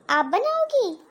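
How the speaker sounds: high, amazed question; can't believe she'll cook; pleasantly stunned disbelief; surprised. Acted. Bhabhi amazed sister-in-law will cook.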